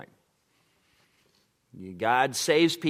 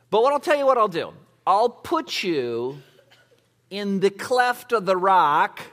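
booming, higher and louder as God speaking; God's voice, preached; 8 semitones higher, 6 dB louder than usual. Acted. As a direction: preach God's words at full force. Voices God's promise, full force.

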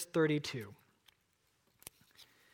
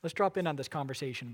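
ordinary delivery

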